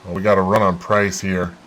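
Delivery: normal talking voice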